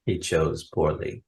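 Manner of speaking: low whispered "He chose poorly"; movie quote whisper. Retold or imitated. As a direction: hush it like the grail knight. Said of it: "Indiana Jones and the Last Crusade fans".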